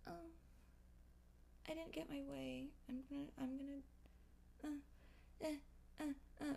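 soft high whiny sputter; imitating a sulking child; mock whimper. Mocking people who whine.

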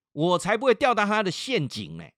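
high, loud, indignant imitation; mother's defiant retort. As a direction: refuse hotly as the mother. (模仿妈妈不服气地顶嘴)

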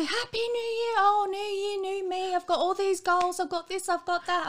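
high, loud, syrupy mockery; mocking cheery New Year voice. Mocking everyone's New Year posts, high sugary voice. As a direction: parody the gushing posts. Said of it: "Everyone's on there".

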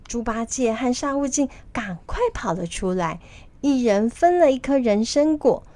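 ordinary delivery, nothing marked

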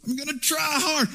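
mock determined promise; mock earnest effort. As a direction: play the eager striver. Mocking self-reliant believer.

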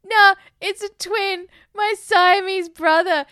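high, loud, slow mock-drunk; hammed-up tipsy confession. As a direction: insist drunkenly, stretching the words. Drunk character explains to woman.

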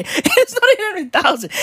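high, giggly correction; laughing. Real, not acted. Host admitting he was just joking, laughing as he corrects himself.

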